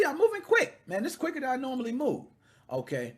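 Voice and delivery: usual speaking voice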